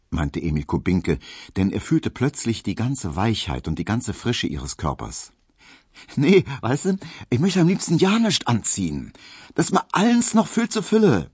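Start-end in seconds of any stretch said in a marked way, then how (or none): 6.1-11.3 very high, loud Berlin dialect; brash Berlin woman. Acted. Hedwig scoffs at wearing a corset, brash and lively. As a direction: exclaim it high and unabashed.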